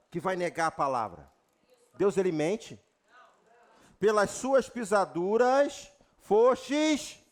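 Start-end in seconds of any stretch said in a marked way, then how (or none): 4.0-7.2 slow, weighty scripture call; slow proclamation. Real, not acted. Preacher proclaiming healing verse to the church. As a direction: lay down each word slowly.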